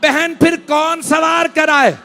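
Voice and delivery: high, loud, wailing majlis recitation; lament recitation at full force. Acted. Preacher reciting Karbala's sorrows to mourners, loud wailing lament. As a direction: cry the tragedy out to the mourners.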